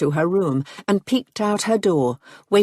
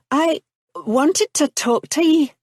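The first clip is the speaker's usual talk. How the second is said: put-on higher, lilting young woman's voice with a Scottish touch; Marianne's voice; 10 semitones higher than usual. A narrator's reading. Young woman comes to his room, hesitant and high.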